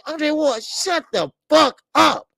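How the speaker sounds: high, loud angry yell at the TV; angry outburst. Retold or imitated. Fan yelling at boxer on TV, high loud angry. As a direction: yell it at the screen, fed up. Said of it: "I was like, and say".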